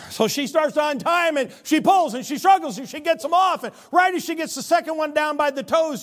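high, loud exasperated telling; energetic comic narration; animated struggle. A narrator's reading. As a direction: build the tugging. Tells the struggle with boots.

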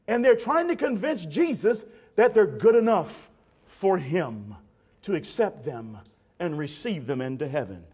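normal talking voice

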